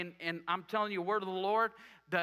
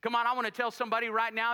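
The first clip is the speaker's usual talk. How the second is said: usual speaking voice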